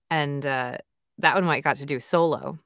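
usual speaking voice

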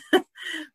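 short high burst of laughter; a quick startled laugh; surprised laugh. Real, not acted. Guest laughing at a surprising detail, short high laugh. Reacting to father beating story.

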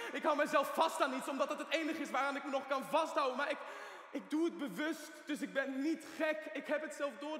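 cling to your sanity out loud; desperate insistence. Acted. Patient insists he is not mad. (wanhopig en luid volhouden)

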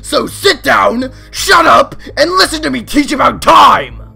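loud, stern shouted order; angry teacher. Acted. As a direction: snap at the class to listen. Clock teacher loses temper.

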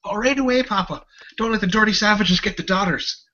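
put-on high, loud excited child voice; eager little boy. Young son answering his papa, high eager child voice. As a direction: pipe up like an eager kid obeying his father.

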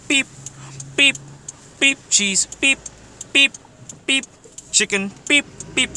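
short high imitated beeps; checkout scanner beeps. Cashier scanning groceries all day, monotonous beeping. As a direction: beep like a till scanning items. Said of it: "taking broccoli and going".

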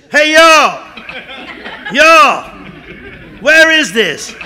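high, loud hail across the water; calling out. Acted. Chris leaning over the rail hailing the beach, high and loud. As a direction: shout to the people on shore. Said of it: "leans over the rail ... says".

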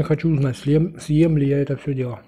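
eating between words; talking while chewing. Real, not acted. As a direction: mouth partly full of food. Seen 2: Dumpling eaten just before; lips pursed, jaw working while speaking.

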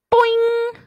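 high, loud boing; spring the word up; bouncy boing. A narrator's reading.

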